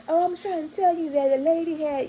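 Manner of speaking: a high, hurried voice for the flustered woman. Retold or imitated. Woman explaining to the police, lightly voiced high and flustered. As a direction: play her scrambling to explain.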